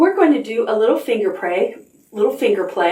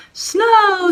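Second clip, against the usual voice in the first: high, loud excited squeal; 12 semitones higher, 3 dB louder than usual. Acted. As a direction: squeal it like a thrilled little girl. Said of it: "squealed Sophie".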